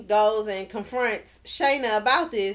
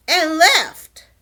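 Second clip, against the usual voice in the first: ordinary talk, nothing marked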